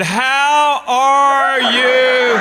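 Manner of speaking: very slow and loud, high and stretched, as if to a foreigner; slow loud greeting. Retold or imitated. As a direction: greet her as slowly and loudly as she once spoke to you. Mock slow greeting to teacher.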